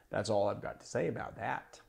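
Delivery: a hint of Forrest Gump, said low and quietly; quiet low Gump imitation; Forrest Gump impression. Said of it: "He says, ... Like that".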